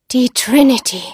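loud, ominous proclamation of a ship's name; old seer's loud pronouncement; dramatic exclamation. Acted. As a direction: cry it out as a dread announcement.

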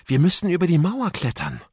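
ordinary delivery

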